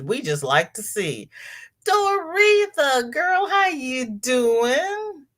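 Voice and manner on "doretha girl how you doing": drawn-out greeting. Real, not acted. Greeting a viewer.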